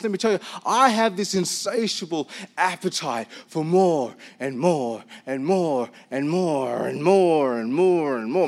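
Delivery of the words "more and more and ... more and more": slow, lowered, swelling chant of wanting; drawn-out greedy repetition. Acted. Pastor confesses insatiable appetite to congregation, drawn-out and greedy. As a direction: stretch every repeat with growing hunger.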